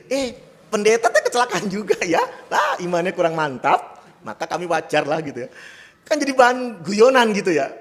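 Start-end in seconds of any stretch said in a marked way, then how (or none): 0.1-4.0 higher, louder teasing jibe, laughing; mock the pastor with a laugh. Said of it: "orang kan nyindir gitu". (sindiran mengejek sambil tertawa)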